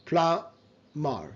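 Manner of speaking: "প্লামার" drawn out slowly as PLA... MAR; slow teaching model of "প্লামার"; syllable-by-syllable demo. Shown as a demonstration. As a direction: break "প্লামার" in two slow halves, no b. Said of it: "but it's a plumber".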